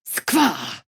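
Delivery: sharp, loud curse; loud combat bark; battle shout. Acted. Battle cry line.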